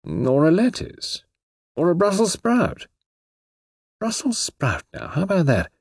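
a touch of desperate brainstorming; lightly voiced Anthony musing. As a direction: toss out ideas half to himself. Anthony musing aloud in thought.

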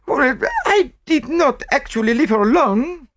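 lightly acted reluctance, very high voice; reluctant French maid. A narrator's reading. French maid admits to the inspector, reluctant. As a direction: admit it grudgingly, pitched high. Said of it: "admitted Celestine reluctantly".

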